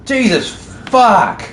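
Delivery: shouted disbelief; loud exasperated swearing. Real, not acted. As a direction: blurt it out loudly. Shocked at four-hour playtime.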